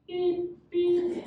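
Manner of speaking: high, steady electronic beeps; monotone high-pitched beep; imitated reversing beeper. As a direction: mimic a vehicle backing up. Imitates buggy backing up.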